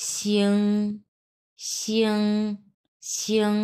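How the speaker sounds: শিং as a gliding "shi-ong", held level; pronunciation drill. Shown as a demonstration. Teacher drilling the word, sliding level "shyong". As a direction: draw শিং through a "yo" glide to "ng". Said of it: "এটা হচ্ছে".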